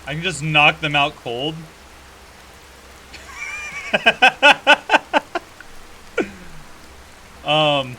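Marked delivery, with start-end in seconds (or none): 1.6-7.4 long high cackling laugh; loud laughter. Real, not acted. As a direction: burst out laughing hard. Laughs at knockout.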